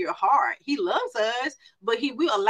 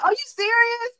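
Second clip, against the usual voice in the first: much higher, amused disbelief; laughing disbelief; 4 dB louder than usual. Retold or imitated. Mentor laughing at crying friend, amused disbelief. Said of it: "I started laughing".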